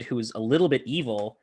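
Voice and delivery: normal talking voice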